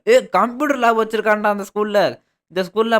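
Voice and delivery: loud, high exclamation of wonder; amazed excitement. Real, not acted. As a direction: call out a thrilling find. Discovers computer lab in the school.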